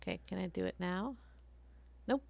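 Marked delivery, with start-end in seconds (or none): none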